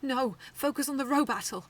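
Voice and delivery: loud urgent command; ordered. A narrator's reading. Girl ordering her bot in a battle, loud. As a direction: call out the order sharply. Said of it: "I ordered".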